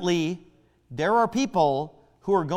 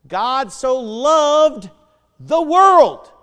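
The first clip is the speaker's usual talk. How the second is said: slow, then "the world" shouted high and much louder; preaching shout; 6 semitones higher, 9 dB louder, much slower than usual. Acted. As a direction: stretch "God so loved", then blast "the world". Verse climaxes in a shout.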